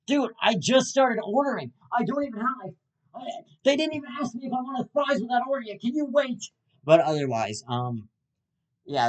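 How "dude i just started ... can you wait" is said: high, fast exasperated protest; defensive whine. Retold or imitated. Podcaster protests to impatient driver, high whiny. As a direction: whine back at the guy behind.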